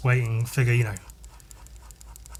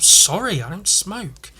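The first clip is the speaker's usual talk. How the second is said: higher-pitched retold apology; acted helpless apology; apologetic reply; 6 semitones higher, slower than usual. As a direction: plead sorry to someone angry. Retold apology to angry woman.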